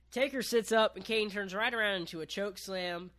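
usual speaking voice

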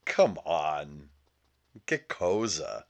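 a light, slow Italian-American accent in mock dismay. An Italian viewer reacting to the pop star, mock Italian-American accent. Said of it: "If I'm Italian and I'm watching that".

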